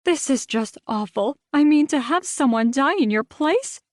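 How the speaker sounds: rising, fretful busybody voice; gossipy rant. Acted. Rita launching into a phone rant, high and fussy. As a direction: pile on the drama. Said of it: "launching into a rant".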